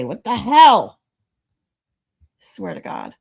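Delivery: loud fed-up what the hell; exasperated. Real, not acted. Streamer fed up with the failing stream, loud. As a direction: let it rip in frustration. Streamer angry at broken software.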